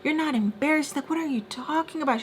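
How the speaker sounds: high-pitched mock scolding; retold yelling. Friend yelling at Mister Peach, retold as high-pitched scolding. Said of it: "she's, like, yelling at him".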